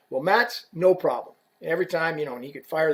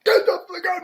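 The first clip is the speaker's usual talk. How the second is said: very high, strained mock scream; retold scream; 14 semitones higher, 3 dB louder than usual. Player screaming at his stick rep, high strained mock scream. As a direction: imitate a man screaming someone out of the room. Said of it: "he's screaming at me".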